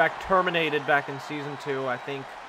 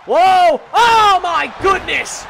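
much higher and louder, slowed and stretched; ecstatic shout; 11 semitones higher, 8 dB louder, much slower than usual. Real, not acted. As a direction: lose it over the big move. Stunner makes commentator erupt.

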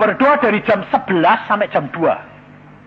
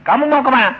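put-on louder, higher call to a fading child; 7 semitones higher, 8 dB louder, faster than usual. Father calls out loudly to his dying daughter. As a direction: call her name loudly to keep her here.

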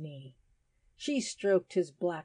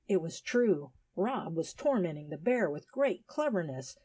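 usual speaking voice

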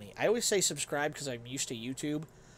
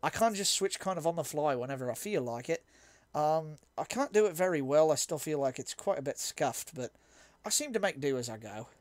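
slightly scuffed put-on British accent; conversational mock-British, self-deprecating. Shown as a demonstration. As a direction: slip into an amateur British accent. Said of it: "Yes, sudden British appeared".

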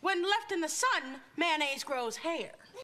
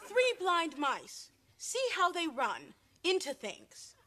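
slow, sing-song rhyme; slow nursery rhyme; 2 semitones higher, slower than usual. Acted. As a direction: drawl it out like a lullaby.

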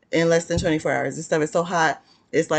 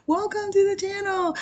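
squeaky high greeting. Pretend. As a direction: sing it in a tiny high voice.

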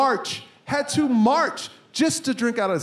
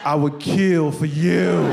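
slow, loud, mock-menacing devotion; menacing vow; 5 semitones lower, 5 dB louder, slower than usual. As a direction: drag out each word like a deadly promise. Vowing to newborn daughter in retelling.

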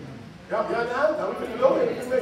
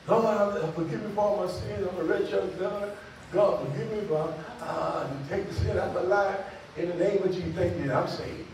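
imitated softer, lower beginner's prayer; new convert praying; 4 semitones lower, 8 dB quieter than usual. Preacher imitating newly saved sinner praying, subdued and halting. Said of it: "That's stuff you say when you first get saved".

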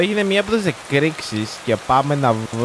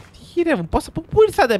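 much higher, surprised outburst; startled; 13 semitones higher than usual. Real, not acted. Streamer caught by guards, startled high outburst. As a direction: jump at the sudden enemies. Enemies suddenly appear.

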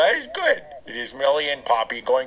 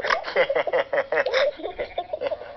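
laughing with repeated snorts; can't stop snorting while laughing; snorting giggles; 6 semitones higher than usual. Acted. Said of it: "now I can't stop snorting".

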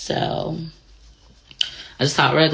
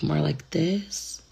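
soft proper voice; 9 dB quieter, much slower than usual. Shown as a demonstration. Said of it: "my real voice back in the day was, like".

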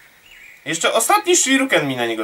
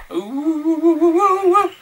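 long wavering whoop climbing high; rising whoop; 9 semitones higher, 3 dB louder, much slower than usual. Real, not acted. Gamer leaping through the level, rising whoop. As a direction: whoop louder and higher as it goes. Noise while jumping in game.

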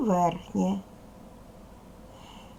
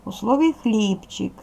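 ordinary delivery

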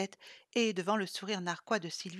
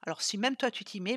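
plain reading voice

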